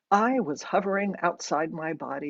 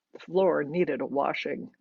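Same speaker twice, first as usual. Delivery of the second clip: usual speaking voice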